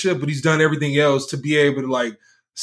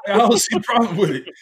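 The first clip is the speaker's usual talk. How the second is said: mock Harden voice; 6 semitones higher than usual.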